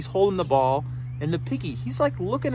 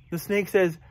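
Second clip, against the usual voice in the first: ordinary delivery, nothing marked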